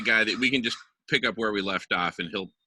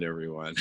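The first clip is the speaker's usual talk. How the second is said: high laugh; 9 semitones higher, 3 dB louder than usual. Real, not acted. Host laughs at own joke.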